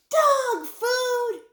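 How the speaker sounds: loud, very high-pitched outraged shriek. A narrator's reading. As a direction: shriek it for the whole store to hear. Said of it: "shrieked the manager in a voice".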